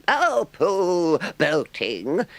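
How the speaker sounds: very high, loud scoff; great disgust. A narrator's reading. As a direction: toss it off with contempt. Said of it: "in great disgust".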